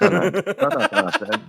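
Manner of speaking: high, laughing imitation of the exasperated listener; laughing mock rant. Mocking French singers in English, high laughing rant. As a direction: squeal it out through laughter, mocking. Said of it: "C'est comme".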